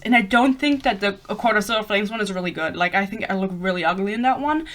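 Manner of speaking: ordinary talk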